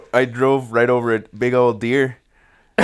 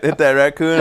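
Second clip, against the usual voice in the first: ordinary delivery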